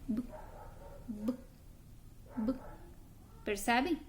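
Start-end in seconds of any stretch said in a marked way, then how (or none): 0.1-2.5 B cut very short with no vowel; B as a tiny dry "b" sound; clipped B. Shown as a demonstration. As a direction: pop the B and stop. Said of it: "ele vai sair bem curtinho".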